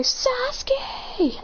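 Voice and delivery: high squealing fangirl cry. Retold or imitated. Fangirls crying out for Sasuke, high squeal. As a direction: squeal his name like a fangirl.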